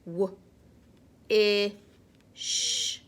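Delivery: W, I, sh sounded separately; sounding out words. Shown as a demonstration. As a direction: sound each letter apart. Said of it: "let's read this one".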